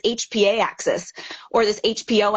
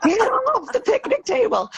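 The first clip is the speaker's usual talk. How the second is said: mock yell; 13 semitones higher than usual. Retold yelling at kids on playground.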